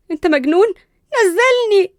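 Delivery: very high, loud protest; outraged shriek. Acted. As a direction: yell it in outraged alarm. Lifted off the floor, protests.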